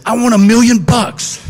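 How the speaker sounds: high, loud excited blurt; excited shout. Retold or imitated. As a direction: burst out with the wish. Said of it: "And I was so excited".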